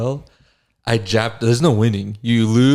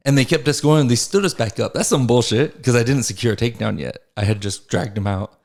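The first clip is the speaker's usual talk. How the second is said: ordinary talk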